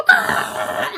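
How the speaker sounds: high laughing squawk; imitated chicken scream. Imitating the chicken yelling in the video, high laughing squawk. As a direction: shriek like the angry hen. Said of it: "she's just like".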